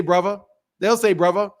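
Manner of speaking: "brother" the British way, with a V for the TH; British "bruvva". Shown as a demonstration. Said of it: "They say brother".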